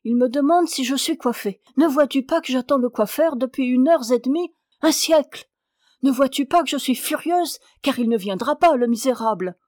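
wife fuming at her husband about the late hairdresser, loud and impatient; impatient outburst. Acted. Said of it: "avec impatience".

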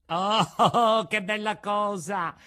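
high, loud burst of joy with a laugh; joyful cry. A narrator's reading. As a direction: jump for joy as you cry it out. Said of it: "gridò Pinocchio, saltando dall'allegrezza".

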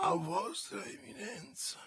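a frightened breathless whisper. A narrator's reading. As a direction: whisper it in dread. Echoes the title in awe.

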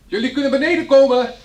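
loud, high call from below; calling upstairs. Acted. Bill calls to the women upstairs, loud high call. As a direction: project it up the stairs.

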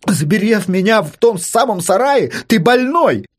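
high, outraged retort; indignant protest. Acted. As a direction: protest angrily at whoever locked you in. Locked-in man protests.

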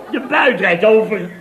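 loud high burst breaking into a laugh; gleeful inner cheer. Retold or imitated. Pleased with himself at the table, a loud laughing cheer. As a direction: blurt it out, half laughing with delight.

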